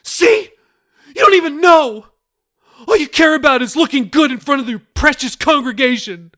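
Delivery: very high, loud yell; furious yelling. A narrator's reading. Daughter yells at her pastor father, very loud. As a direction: scream it in his face.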